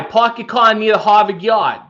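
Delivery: high, broad Boston twang; Boston accent. Shown as a demonstration. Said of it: "Do the old standby".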